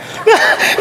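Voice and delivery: a short unvoiced chuckle; breathy laugh. Real, not acted. Preacher chuckling at his own diabetes joke, airy laugh.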